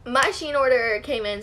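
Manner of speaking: normal talking voice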